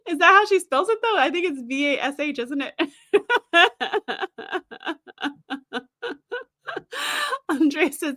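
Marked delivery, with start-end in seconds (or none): 3.1-5.8 long real laugh trailing off. Real, not acted. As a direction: keep laughing at the cow joke. Laughing at chat joke.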